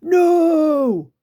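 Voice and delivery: the creature yelps from under the basket; creature grunt. Acted. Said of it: "grunted Gruff".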